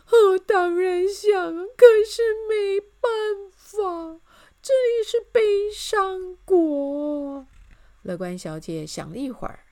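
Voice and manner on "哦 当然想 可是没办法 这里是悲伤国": high, loud, very slow tearful wail; wailing lament. A narrator's reading. King admits he wants happiness, wailing. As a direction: draw every phrase out in a sob. Crying king answers her question.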